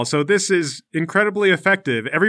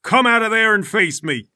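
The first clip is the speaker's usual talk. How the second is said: higher, forceful acted yell; yelled challenge; 5 semitones higher, 3 dB louder than usual. Bald fighter to Larry in his fortress, yelling. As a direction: call out an opponent hiding inside. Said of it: "he yells in".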